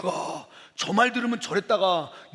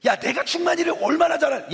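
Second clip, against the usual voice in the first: indignant defence; 7 semitones higher, 4 dB louder than usual. Acted. Acts indignant defence of a trusted friend.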